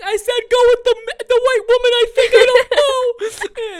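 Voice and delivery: very high, loud, laughing squeal; mock panic. Embarrassed student confessing the bad translation to teacher, mock panic. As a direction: squeal it in hammed-up helplessness, half laughing.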